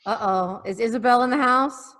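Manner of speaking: ordinary delivery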